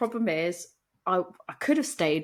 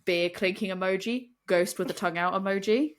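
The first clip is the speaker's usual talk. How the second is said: ordinary talk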